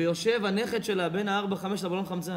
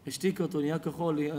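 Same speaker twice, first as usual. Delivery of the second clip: usual speaking voice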